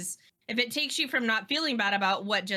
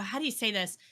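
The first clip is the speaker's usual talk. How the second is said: ordinary delivery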